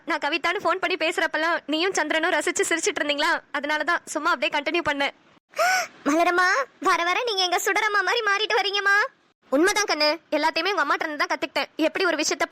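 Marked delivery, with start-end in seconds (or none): none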